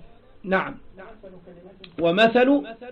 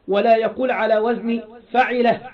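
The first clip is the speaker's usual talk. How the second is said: usual speaking voice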